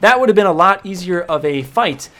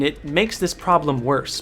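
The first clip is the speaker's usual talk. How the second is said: ordinary talk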